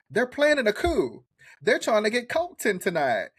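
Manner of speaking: put-on a teasing take on a sudden Alabama accent; imitating Southern accent. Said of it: "that accent was so Alabama".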